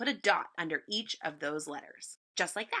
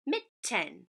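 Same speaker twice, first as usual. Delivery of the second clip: Mitten split as mit, ten with a gap; separate chunks. Shown as a demonstration. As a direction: Mitten syllable by syllable, evenly parted. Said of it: "Put them together".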